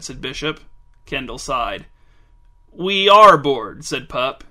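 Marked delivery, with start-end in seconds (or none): 2.7-3.8 slow, loud, drawn-out admission; bored teen owning up to it. A narrator's reading. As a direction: lean long and hard on "are", bored. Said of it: "low and bored-sounding as ever".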